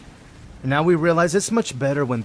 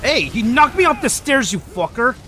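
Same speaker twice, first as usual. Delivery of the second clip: sudden loud, higher yell at the enemy; angry outburst; 10 semitones higher, 6 dB louder than usual. Real, not acted. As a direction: snap at the guy who knocked you off. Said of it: "was that aggressive?".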